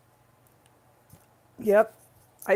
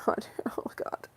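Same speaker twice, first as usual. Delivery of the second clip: laughing whisper to herself; whispered aside; 8 dB quieter than usual. Shown as a demonstration. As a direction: giggly whisper of the phrase. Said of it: "This is my hot hair".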